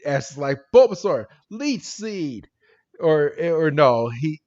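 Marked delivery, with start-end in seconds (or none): 0.7-2.5 higher-pitched call, loud on the name; imitated battle command. Ash sends Bulbasaur to attack, mock battle cry. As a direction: call the attack like a cartoon trainer.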